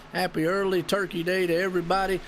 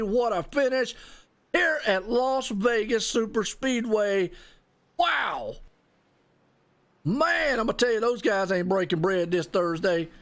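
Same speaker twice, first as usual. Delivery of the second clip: ordinary talk, nothing marked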